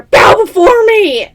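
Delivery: hammed-up dragon bellow; mock dragon roar. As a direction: roar it down at the goblins like a tyrant dragon. Dragon roleplay commanding minions.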